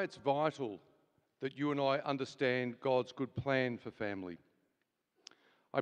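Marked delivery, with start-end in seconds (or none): none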